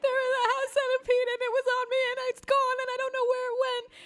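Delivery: very high, fast panicked rush. Retold or imitated. Telling mom about the centipede, frantic and very high. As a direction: blurt it out in a frenzy. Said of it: "I'm like".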